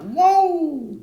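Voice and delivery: high, loud whoop of delight; excited whoop. Real, not acted. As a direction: let out a big happy whoa. Excited shout at wrap-up.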